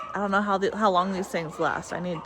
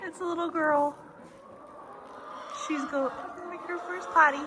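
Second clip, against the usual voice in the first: delighted; 8 semitones higher, 4 dB quieter than usual. Real, not acted. Delighted reveal of lamb.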